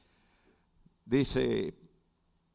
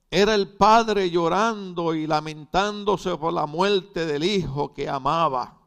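loud, raised pulpit proclamation; forceful preaching; 5 semitones higher, 8 dB louder than usual. Real, not acted. Preacher defending pastors who weep, forceful preaching. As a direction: drive each statement out to the congregation.

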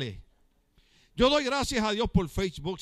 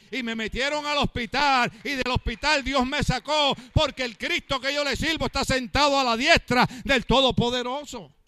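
loud, driving sermon voice; fiery preaching; 3 semitones higher, 4 dB louder than usual. Acted. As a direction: preach it out to the whole church. Pastor preaching at full force.

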